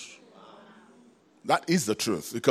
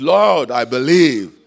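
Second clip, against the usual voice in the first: loud, slow cry of Lord, I believe; loud tearful cry of the boy's father; crying plea; 4 semitones higher, 11 dB louder than usual. Retold or imitated. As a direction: plead through tears. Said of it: "gave a cry with tears".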